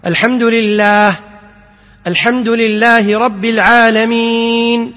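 slow chanted Quran recitation. Shown as a demonstration. Teacher reciting the verse slowly to students.

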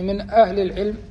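ordinary talk

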